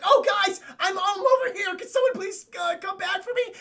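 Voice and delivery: high, loud whiny plea; whiny pleading. Retold or imitated. Abandoned guy pleads with the group, high and whining. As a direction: beg to be fetched in a high loud whine. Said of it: "Topher Grace is like".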